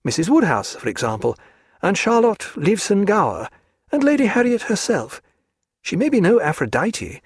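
a spirited lady mocking her hostess; Diana's voice. A narrator's reading.

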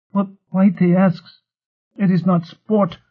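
high, slow creature voice; insistent creature. A narrator's reading. Whitey rebukes Lot, high slow creature insistence.

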